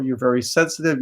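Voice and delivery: normal talking voice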